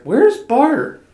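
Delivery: high, loud baffled cry; retold alarm. Child noticing little brother gone, acted alarmed question. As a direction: call out for someone suddenly missing, played up. Retelling discovering brother missing.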